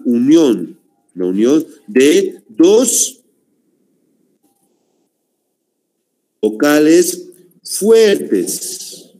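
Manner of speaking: hiato es la unión de dos vocales fuertes, slow and drawn out; slow dictation. Shown as a demonstration. Teacher to class, slow dictating voice. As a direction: dictate each word slowly to the class. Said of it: "teacher dictating definition".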